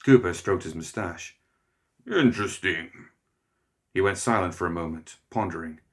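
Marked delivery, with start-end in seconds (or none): 2.1-3.1 drawn-out, slow interesting; slow musing hunter. A narrator's reading. Cooper pondering the news, slow and musing. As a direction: let it hang, musing.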